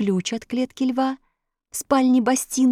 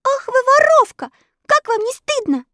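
put-on indignant girl; 5 dB louder than usual. Elli scolds the thief.

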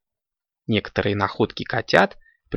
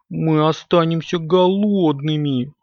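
slow, higher drawled whine; drawn-out complaint; 5 semitones higher than usual. A narrator's reading. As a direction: stretch the words out plaintively. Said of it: "протянул Компот".

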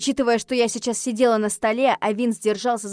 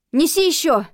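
high, loud urgent order; urgent shouted demand; commanding; 8 semitones higher, 7 dB louder than usual. A narrator's reading. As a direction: call out a sharp command. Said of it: "скомандовала я".

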